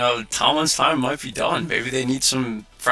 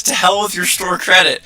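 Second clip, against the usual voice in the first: louder, higher mock outburst; retold angry retort; 6 semitones higher, 6 dB louder than usual. Customer throwing the card at the clerk, angry outburst. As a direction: spit it out as she throws the card. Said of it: "she throws the store credit at me and says".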